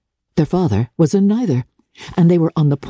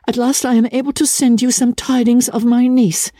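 higher-pitched voice reading out a letter; reading letter aloud; 5 semitones higher than usual. Acted. Letter read aloud at father's request.

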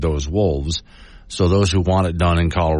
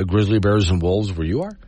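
ordinary talk, nothing marked

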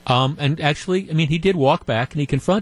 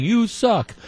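high-pitched heckle at normal volume; mild mock scream; 9 semitones higher than usual. Cubs fan screaming at Christie walking past, mild mock scream. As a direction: imitate a fan's jeer, pitched well up. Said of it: "He's screaming".

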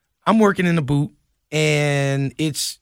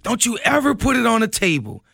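angry outburst; 7 semitones higher than usual. Retold or imitated. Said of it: "He flips out".

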